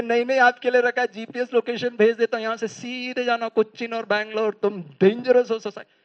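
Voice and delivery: mocking reply. Listener sarcastically sends the speaker to the asylum. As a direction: sneer that he belongs in the asylum. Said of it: "बोलते".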